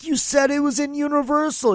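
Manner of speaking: high-pitched mock indignation; indignant protest. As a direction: re-enact a squeaky accusing protest. Retold argument over the location.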